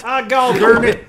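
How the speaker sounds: loud mock Southern cussing; Southern accent. Host plays along as a nascar podcaster, mock Southern accent. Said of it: "we're a Southern nascar podcast".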